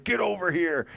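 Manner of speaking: lightly imitated video game catchphrase; Scorpion. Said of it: "that Scorpion uses, and he's like".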